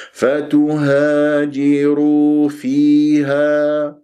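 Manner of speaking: slow chant, open long vowels in فتهاجروا فيها; natural madd. Shown as a demonstration. Teacher models the stop on فيها, slow recitation. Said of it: "بهذه الط".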